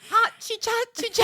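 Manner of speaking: high, loud mock chicken call; bad chicken impression. Friend quoting Arrested Development chickens, absurd high squawk. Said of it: "what a chicken sounds like".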